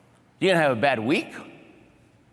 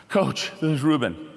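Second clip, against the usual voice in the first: mock breathless radio call; out of breath. Athlete radioing coach after a bad run, out of breath. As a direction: heave a breath, then report in, winded. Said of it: "I'm out of breath".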